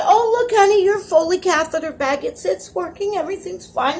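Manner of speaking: high-pitched nurse brushing off a worry; Daisy. Retold or imitated. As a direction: imitate a reassuring nurse, pitched well up. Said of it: "Daisy comes in".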